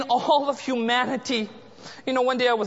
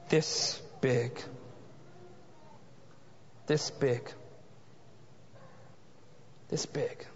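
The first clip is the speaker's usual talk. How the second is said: softer each time until it is breathed; fading to a whisper; 11 semitones lower, 14 dB quieter, slower than usual. Real, not acted. Pastor remembering a child's coffin, fading to a whisper. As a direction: let the voice die away to a whisper.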